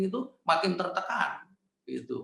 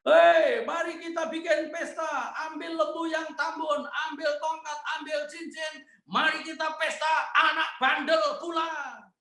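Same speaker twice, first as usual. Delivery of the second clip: joyful shouting father; 11 semitones higher than usual. Retold or imitated. Father welcoming home his lost son, shouting with joy. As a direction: yell for the feast across the yard. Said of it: "papanya langsung teriak-teriak".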